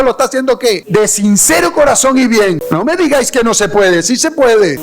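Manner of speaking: high and loud, rising fervour; preaching at full force. Acted. Preacher insisting to the congregation it can happen, fiery and loud. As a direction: drive it home like a revival peak. Preacher on Saulo killing in God's name.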